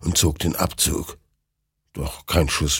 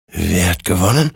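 ordinary delivery, nothing marked

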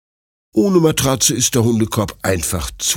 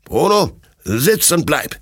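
firm, raised voice of an owner ordering his dog; loud stern command; 7 semitones higher than usual. A narrator's reading. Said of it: "mit lauter und strenger Stimme". (laute, strenge Stimme)

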